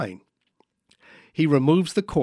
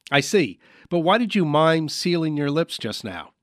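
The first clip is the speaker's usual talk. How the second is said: normal talking voice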